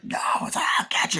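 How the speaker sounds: voiceless, high monster voice; mock creature threat; imitated demon threat. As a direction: menace as a chasing demon. Demon chase acted out.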